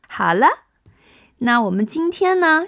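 normal talking voice

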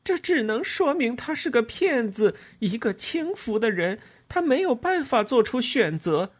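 high-pitched angry denunciation; heated, high, insistent yell; shouting at him. A narrator's reading. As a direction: cry out the accusation, pitched high throughout. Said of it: "我对着他喊道".

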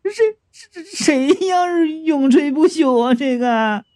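girl bursting into tears at a bad joke; crying. A narrator's reading. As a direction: bawl through the words. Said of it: "哇一声就哭了".

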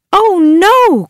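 high loud alarm; alarmed cry. Acted. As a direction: cry out in dismay. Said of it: "cried Joan and Bob".

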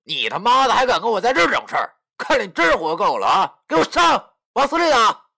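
loud, high, enraged shouting; 破口大骂; furious cursing. Acted. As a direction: scream at your gang to attack. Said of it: "指着我大骂道".

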